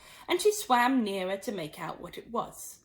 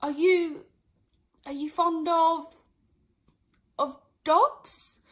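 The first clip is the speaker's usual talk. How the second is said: higher, halting question; hurried hesitant; 7 semitones higher, 5 dB louder, slower than usual. Acted. Alice nervously changing the subject. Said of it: "in a great hurry to change the subject".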